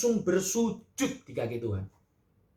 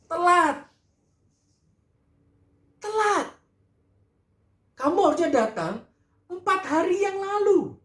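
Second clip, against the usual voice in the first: crying reproach; 6 dB louder than usual. Acted. Marta breaking down.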